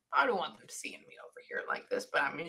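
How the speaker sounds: soft voiceless whisper. Real, not acted. Medium relaying the spirit's words in a whisper. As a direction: hush it like a confidence.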